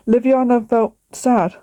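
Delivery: usual speaking voice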